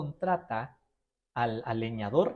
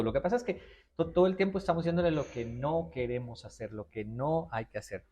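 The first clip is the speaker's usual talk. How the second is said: ordinary delivery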